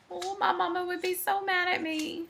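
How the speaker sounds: high, soft little voice; small, high-pitched fretting about mama; softly voiced kid in trouble; child's worried reply.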